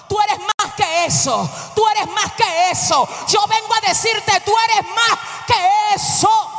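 insistent refrain, building in force; impassioned preaching. Real, not acted. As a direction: drive it home harder each time, stretching the last one.

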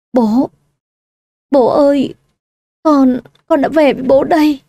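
a faked, pouting sob to her father; fake sobbing. A narrator's reading. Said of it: "Cô mếu máo khóc lên vài tiếng và gọi bố". (giả vờ mếu máo khóc gọi bố)

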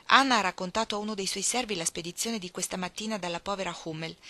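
plain reading voice

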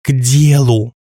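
trembling, rattling voice; quavering voice. A narrator's reading. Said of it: "дребезжащим голосом потребовала Юлька". (дребезжащий голос)

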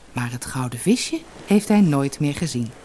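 plain reading voice

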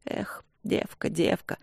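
hushed, breathy sigh of worry, slow; sorrowful whisper. A narrator's reading. Mother sighing over her lovesick daughter, whispered.

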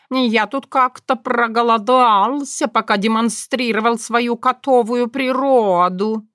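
hint at food, pompous; cat voice. A narrator's reading.

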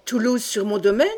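a startled, indignant outburst; shocked cry. A narrator's reading. Old count crying out at his cousin's news, shocked. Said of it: "s'écria Rohan".

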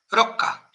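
"rocca" begun with a single tapped r; tap, don't roll, the r of "rocca"; soft initial R. Shown as a demonstration. Host illustrating Italian word-initial R, soft tap. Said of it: "Con una R suave".

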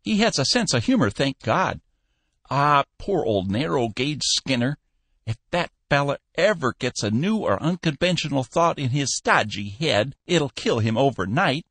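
chuckling to himself about Skinner; lightly voiced old man, amused; old Cappy voice. Cappy musing alone.

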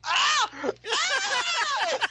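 very high-pitched imitated scream; high, hammed-up panicked yelps; mock freak-out scream. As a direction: shriek like someone pepper-sprayed. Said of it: "Just freaking out".

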